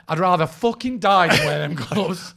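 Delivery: hammed-up disdain of a man refusing gloves; mock proud beggar. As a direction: sneer at the cheap gloves. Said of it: "he's like".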